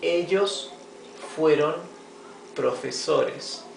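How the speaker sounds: "Ellos fueron profesores" read apart, slowing on profesores. Shown as a demonstration. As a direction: lay each word out for the class. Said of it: "teacher reads example slowly".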